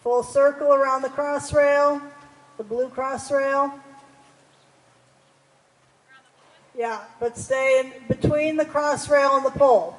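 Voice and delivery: loud, carrying riding instructions; calling across arena. Real, not acted. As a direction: project commands across the arena.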